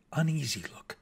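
plain reading voice

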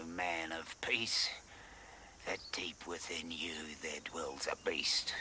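low, soft threatening whisper; menacing whisper. Acted. Coach whispering to the gentle player about his beast within, menacing whisper. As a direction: lean in close and breathe the threat.

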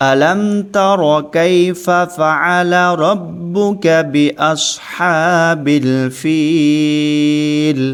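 very slow, drawn-out melodic tajweed; Quranic recitation. Shown as a demonstration. Teacher chanting the verse for students to repeat. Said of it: "সম্পূর্ণ পড়ুন".

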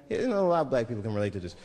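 usual speaking voice

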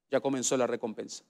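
normal talking voice

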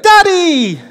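put-on high excited child's call; kids calling. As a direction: shout it like a little kid spotting dad.

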